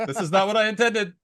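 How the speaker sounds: hammed-up disappointed storyteller; exasperated [name].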